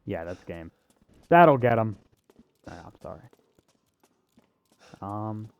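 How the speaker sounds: normal talking voice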